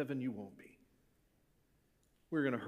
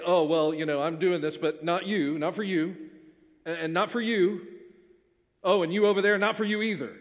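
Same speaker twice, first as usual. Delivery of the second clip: louder, pointing out each one with scorn; mock dismissive Jesus; 4 semitones higher, 9 dB louder than usual. A preacher mocks an imagined petty Jesus, scornful. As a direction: sneer it out, singling people out.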